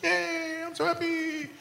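very high, soft mock-happy voice; tiny high-pitched cheer, mimicking her; squeaky little voice of glee; happy squeal voice. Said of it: "you have such a happy walk".

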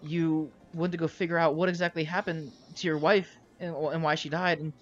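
put-on earnest young-sounding Darius. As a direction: explain haltingly, then hurry on with hope. Game master plays Darius explaining.

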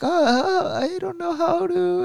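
very high, whiny panicked voice; nervous panic. Retold or imitated. Imitating himself panicking over holding a newborn. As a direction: squeal it in mock panic. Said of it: "I was like".

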